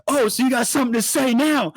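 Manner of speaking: high, loud imitated challenge; retold goading sneer; mock taunt. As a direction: needle him like a drunk bully. Drunk taunting Brandon.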